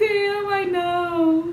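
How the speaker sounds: high, slow, sung wail; whiny sing-song. Retold or imitated. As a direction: drag it out like a sad song. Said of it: "I'm like".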